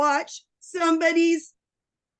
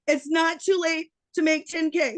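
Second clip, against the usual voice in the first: usual speaking voice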